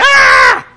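sudden high, loud yell of fright; mock-panicked shriek; mock scared yell. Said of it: "You're like".

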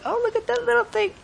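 high, soft cooing over the sonogram; cooing parent. Retold or imitated. A parent gushing at the ultrasound screen, high soft coo. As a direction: coo like a new parent seeing the baby.

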